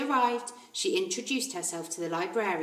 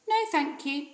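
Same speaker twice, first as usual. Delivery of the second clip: put-on polite little girl; 6 semitones higher than usual. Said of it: "Matilda said".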